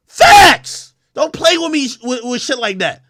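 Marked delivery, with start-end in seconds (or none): 0.1-0.9 sharp high yell of agreement; shouted. Real, not acted. Roasting her on camera, a shouted agreement. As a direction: bark the word out loud and high. Heated rant roasting a rapper.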